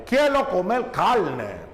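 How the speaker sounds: "Quiero comer carne" as "quielo comel calne"; Central American R. Shown as a demonstration. Teacher repeats the example for the class, all R sounds as L. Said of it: "En Centroamérica no pronuncian la R".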